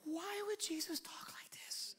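high, hushed aside; baffled whisper. Acted. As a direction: whisper it like a puzzled confidence.

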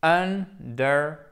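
Understand slowly, with a strong main stress on stand. Shown as a demonstration. Teacher slows down to show the main stress, slow and stressed. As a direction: draw out Understand and punch stand. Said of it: "qui est vraiment le gros accent tonique".

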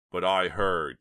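loud, urgent objection; protesting. A narrator's reading. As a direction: object loudly, then get cut off. Chuck protests to the threatening man.

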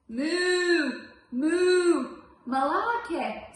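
mooing cow. Acted.

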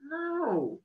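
high, startled no; stunned friend blurting out a denial; dumbfounded. A narrator's reading. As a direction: caught off guard, disbelieving.

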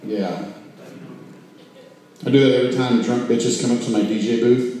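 ordinary delivery

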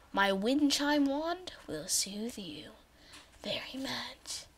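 a playful hypnotic hush; mock soothing whisper. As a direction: whisper it as if lulling them to sleep. Soothing whisper about the wand.

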